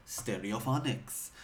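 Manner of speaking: lightly sung-out Welsh key phrase; practised Welsh accent warm-up. Shown as a demonstration. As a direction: slip into a Welsh accent for one word. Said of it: "do the Welsh accent".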